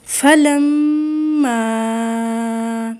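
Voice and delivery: فلما very slow with a long humming nasal م; ghunnah on meem. Shown as a demonstration. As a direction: hold the doubled م of فلما in the nose. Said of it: "میم شد پہ غنہ ہے". (فلما میں میم پر غنہ)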